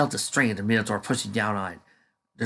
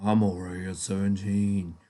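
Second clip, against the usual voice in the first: grunted; 2 semitones lower, 4 dB quieter than usual. Acted. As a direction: grunt it out smugly. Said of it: "grunted Lurtz".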